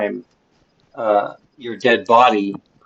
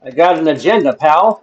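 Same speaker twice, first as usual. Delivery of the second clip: loud, higher, brushing someone off; tough-guy snap; 7 semitones higher, 10 dB louder than usual. Real, not acted. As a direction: warn the zombie off, loud. Telling off a zombie.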